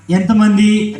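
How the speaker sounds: normal talking voice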